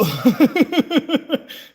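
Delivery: high loud burst of laughter; loud amused laugh. Real, not acted. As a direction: laugh hard at the joke. Laughs after joke.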